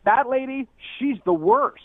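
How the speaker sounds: retold loud, high jab; a high, loud mocking quote. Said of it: "in a really loud voice".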